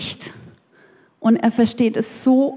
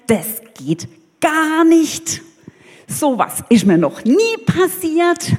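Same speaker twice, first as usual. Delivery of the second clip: higher, offended and outraged; indignant outburst; 4 semitones higher than usual. Acted. Naaman, snubbed by the prophet's servant, rants in high indignation. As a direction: play the insulted general who can't believe it. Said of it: "Und Naaman regt sich auf".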